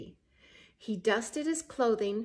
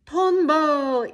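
high, loud cry of fear; fearful shout; 5 semitones higher, 12 dB louder than usual. A narrator's reading. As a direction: shout it out in panic. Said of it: "Issun Boshi shouted in fear".

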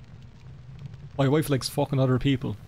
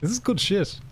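higher, laughing through the words; laughing delight; 6 semitones higher, 3 dB quieter than usual. Real, not acted. Gamer cracking up at a joke book. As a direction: gush while laughing.

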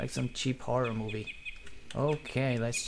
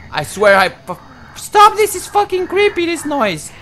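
high, loud panicked screaming; breathless fright; 8 semitones higher, 17 dB louder than usual. Real, not acted. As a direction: scream while running for your life. Chased by a ghost, panicking.

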